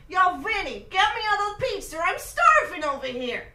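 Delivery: loud, brash Italian American New Yorker; hammed-up Italian American accent, calling across the room. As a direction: shout it like a hungry guy from Brooklyn. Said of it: "practice my Italian accent".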